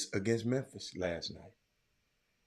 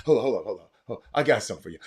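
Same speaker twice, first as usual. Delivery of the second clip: ordinary talk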